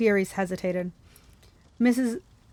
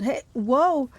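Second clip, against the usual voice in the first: higher, swooping cry of protest; alarmed yell; 5 semitones higher, 3 dB louder than usual. A narrator's reading. Bus driver fighting for the wheel, acted alarmed yell. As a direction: cry out as if losing control. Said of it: "the driver yelled".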